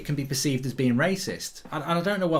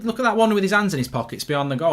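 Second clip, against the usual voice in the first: usual speaking voice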